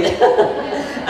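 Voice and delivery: high, airy burst of laughter; amused laugh. Real, not acted. Teacher laughing after his mock apology, amused. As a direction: laugh at your own joke.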